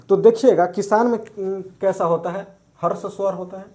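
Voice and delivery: usual speaking voice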